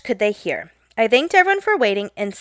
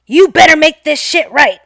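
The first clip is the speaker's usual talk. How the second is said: louder and higher, an imitated old man's furious yell; mock shriek; 5 semitones higher, 7 dB louder than usual. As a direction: bark it at her like a cranky old customer. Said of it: "As loudly as possible, he shrieks".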